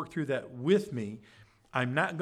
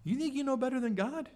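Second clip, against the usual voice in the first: higher, quick, indignant challenge; challenging; 9 semitones higher, faster than usual. Acted. Bildad confronting Job, higher, fast indignant challenge. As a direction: throw the question back at him like an accusation.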